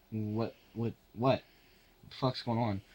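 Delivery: ordinary delivery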